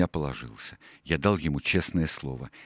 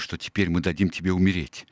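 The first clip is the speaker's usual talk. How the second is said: ordinary delivery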